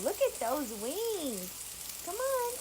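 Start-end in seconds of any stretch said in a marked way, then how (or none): 2.0-2.5 high, sweet coaxing to a pet. Real, not acted. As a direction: gently lure the bird closer. Coaxing a bird to come.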